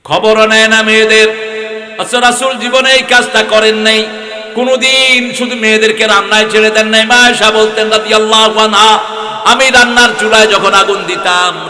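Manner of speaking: loud, high fervent preaching; loud sermon delivery; preaching at full force. Acted. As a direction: drive it home to the crowd.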